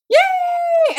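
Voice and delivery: high, loud drawn-out cheer; real delight. Real, not acted. Vlogger celebrating the sticker coming off, delighted cheer. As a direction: cheer it out at the top of your range. Sticker comes off new book.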